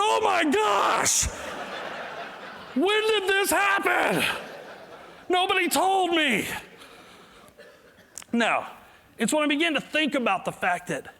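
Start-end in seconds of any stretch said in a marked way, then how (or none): 0.0-6.5 shocked discovery. Retold or imitated. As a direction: squeal it like waking to a shock. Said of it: "that day I woke up and went".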